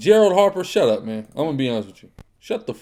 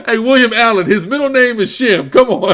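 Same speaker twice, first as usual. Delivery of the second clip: laughing shout; 9 semitones higher, 9 dB louder than usual. Real, not acted. Laughing at a caller's name.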